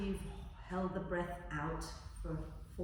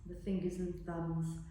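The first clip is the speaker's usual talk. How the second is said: guided meditation; 8 dB quieter than usual. Acted. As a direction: guide them gently into relaxing. Leads a body relaxation.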